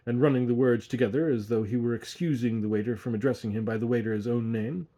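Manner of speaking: usual speaking voice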